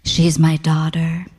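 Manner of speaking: low, slow, breathy purr. A narrator's reading. Mother to Ray about her daughter, breathy. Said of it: "in a breathy voice".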